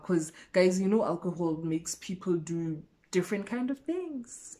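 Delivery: usual speaking voice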